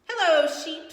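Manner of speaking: chirpy duck saying hello; cheery duck. Acted. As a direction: call out a bright hello as Duck. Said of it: "said Duck".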